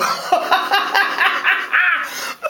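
long, high acted laugh; hearty put-on storybook laughter; crowd's laughter.

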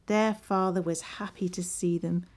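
plain reading voice

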